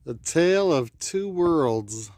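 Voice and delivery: "The tale of two worlds" with every consonant crisp, slow; over-enunciate "The tale of two worlds" slowly; enunciated. Acted. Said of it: "Really enunciated there".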